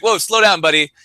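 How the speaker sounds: mock interruption. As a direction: rein in a show-off buddy. Cutting off the pretentious traveler.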